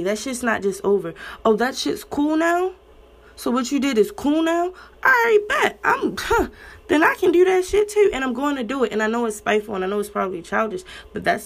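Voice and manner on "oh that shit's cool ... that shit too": sarcastic, higher and louder, incredulous; indignant mock. Mocking partner's excuse, sarcastic and raised. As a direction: throw their excuse back mockingly.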